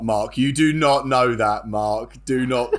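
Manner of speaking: slow, heavy stress on every word; slow emphatic insistence. Real, not acted. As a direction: spell it out slowly and firmly. Insisting Mark is wrong.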